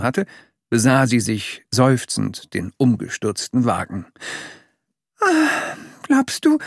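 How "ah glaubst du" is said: Mama Dick. A narrator's reading. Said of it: "fragte sie dann".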